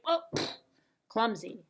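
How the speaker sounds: plain reading voice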